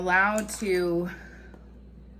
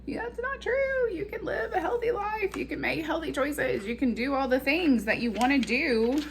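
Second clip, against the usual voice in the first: high, sweet little angel voice; a gentle angel pleading, high and tender; 9 semitones higher than usual. Retold or imitated. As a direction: play the kind angel on your shoulder, pitched way up. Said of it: "the little angel on the other side's like".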